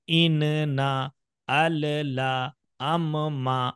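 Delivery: इन ना अल ला अम मा, every syllable pulled apart, slow; slow, broken syllable by syllable; split syllables. Shown as a demonstration. Teacher to children, showing the long broken way. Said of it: "बोलने में भी लंबा बोलना पड़ेगा".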